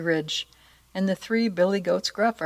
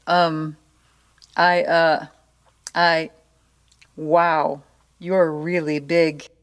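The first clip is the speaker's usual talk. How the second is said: nervous stammer; slower than usual. A narrator's reading. Troll faces huge goat.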